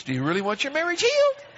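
a jolt of high fast voice to wake them up; attention-grabbing voice. Acted. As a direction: blurt it to grab attention. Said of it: "trying to get your attention".

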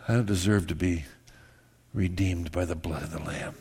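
low, soft, sincere confession; humble confession. Acted. Pastor confessing to the congregation, low and soft.

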